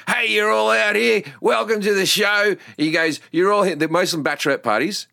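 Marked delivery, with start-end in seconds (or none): 0.1-3.7 a lightly voiced showman hyping the crowd, higher; announcing to the bachelorettes like a showman; stripper host greeting. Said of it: "the guy came out and he said".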